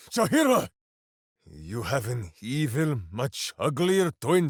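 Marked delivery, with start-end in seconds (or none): 0.1-0.7 high, delighted laughing exclamation; laughing surprise. Acted. Minsk spotting a familiar face, laughing exclaim. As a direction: laugh out the name in disbelief.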